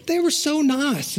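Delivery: high, slow marveling; wide-eyed, drawn-out wonder; acted amazement. Convert amazed by church people.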